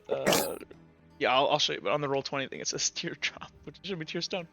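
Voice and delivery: ordinary delivery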